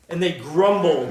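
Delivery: ordinary talk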